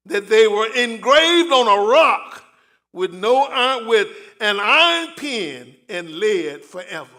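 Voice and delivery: slow, loud proclaimed verse; slow solemn proclamation; preached scripture. Acted. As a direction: declaim Job's words as a vow. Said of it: "He said".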